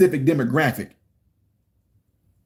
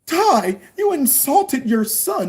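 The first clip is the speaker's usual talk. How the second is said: mock accuser; 9 semitones higher, much slower than usual.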